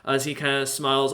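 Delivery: usual speaking voice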